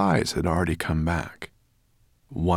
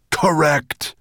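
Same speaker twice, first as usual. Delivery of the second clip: loud growl, pitched up; 11 semitones higher, 9 dB louder than usual. A narrator's reading. Dragon conceding to his servant, in a loud growl. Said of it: "growled Nettlebrand".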